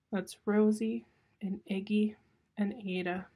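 usual speaking voice

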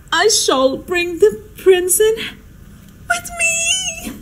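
slow build into a tiny high squeal; giddy triumph. Acted. Hostess about to present her royal guest, giddy triumph. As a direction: savour it, then squeak the ending.

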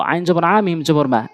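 usual speaking voice

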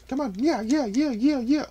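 very high, squeaky put-on yeahs; squealed taunt. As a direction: rattle off yeahs in a tiny falsetto. Taunting the opponent in a fight.

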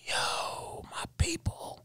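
whispered under the breath, thrilled; a secret little whoop, barely voiced; hushed aside. Waiter spotting Transworld crew, whispered thrill.

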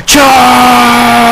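long, high, sustained yell; battle yell. Pretend. Gamer charging into the fight with a long yell. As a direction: let out one held, full-voiced cry.

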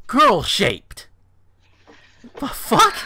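high, loud incredulous echo; appalled disbelief. Real, not acted. Host reacting to girl-shaped, loud disbelief. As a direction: throw the words back, baffled and appalled.

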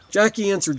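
ordinary delivery

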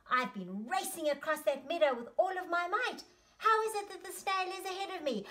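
high, fast protest; baffled disbelief. Acted. Horse finds the snail ahead of him, fast high bewilderment. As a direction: rush it out in rising astonishment.

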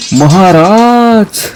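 stretched out in wonder; awed call. Acted. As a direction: draw out the word in amazement. Korade addresses king in awe.

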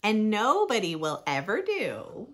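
lively, sing-song picture book reading; reading to children. Acted. Reading a rhyming book to young listeners, bright storytime voice. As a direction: read it like storytime for little ones.

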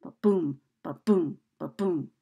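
ba-boom tapped out steadily with a punched BOOM; iambic heartbeat beat. Shown as a demonstration. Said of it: "tap it out".

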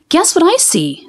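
a little sister teasing with a riddle; lightly voiced young girl. A narrator's reading. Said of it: "said Cleo".

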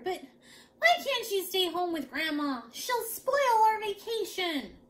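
high, loud whiny complaint; grumbling child. A narrator's reading. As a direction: protest like a put-out little boy. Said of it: "he grumbled".

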